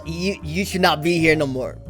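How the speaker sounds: normal talking voice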